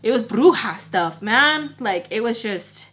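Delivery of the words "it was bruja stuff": ordinary talk, nothing marked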